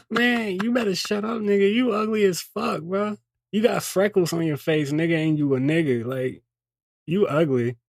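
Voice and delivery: squeaky heckler mocking him; high-pitched alter ego. Alter voice roasting the speaker.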